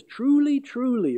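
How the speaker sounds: first "Truly" high, the second "truly" falling; emphatic truly. Retold or imitated. Preacher voicing Jesus with emphatic "Truly, truly". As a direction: lean on "Truly, truly" as Jesus stressing it. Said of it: "he's emphasizing something".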